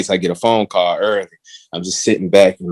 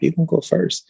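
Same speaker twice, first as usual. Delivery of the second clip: higher-pitched, insistent mom; lightly voiced mom; 6 semitones higher, faster than usual. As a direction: lightly imitate an insistent praying mother. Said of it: "She's like".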